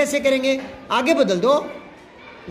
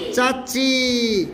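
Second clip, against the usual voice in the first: slow dictation; much slower than usual. Shown as a demonstration. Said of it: "अब चाचा का".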